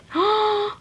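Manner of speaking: a quick surprised gasp in; sharp gasp. Acted. Reader gasps at the magic flash.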